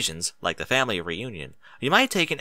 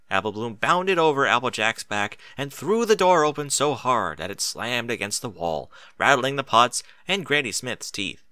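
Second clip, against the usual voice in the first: ordinary delivery, nothing marked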